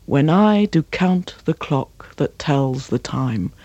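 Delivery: slow, with "I", "count", "clock", "tells" and "time" each stressed; iambic beat. Shown as a demonstration. Coach scanning a perfect pentameter line, slow. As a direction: let the line walk in even heartbeats. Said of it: "and it's perfect".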